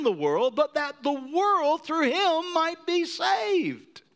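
high, loud, slow preaching; preacher building to a cry; rising preached verse. Acted. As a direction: lift the verse to full force.

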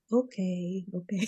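soft, low-key imitation of placating someone. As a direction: say it under your breath, humouring them. Voicing Dorit's placating reply.

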